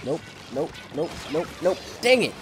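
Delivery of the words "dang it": high, loud outburst climbing to a shriek; frustrated yelling. Real, not acted. Player three away from winning, frustrated rising yell. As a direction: yell it higher each time. Frustrated near miss in game.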